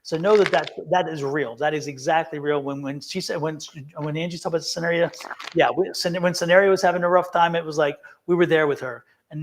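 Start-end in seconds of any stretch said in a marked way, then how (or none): none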